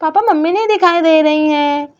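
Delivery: put-on worried little girl calling for mummy. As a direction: play a small girl looking for her mother. Child [name] speaks.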